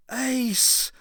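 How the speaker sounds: high, loud alarmed cry; a warning cry to Ace. A narrator's reading. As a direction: cry her name in warning. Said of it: "cried the older peccary".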